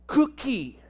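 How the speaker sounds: high, lightly voiced little child; a hint of a toddler saying its first word. Toddler's hard-won first word.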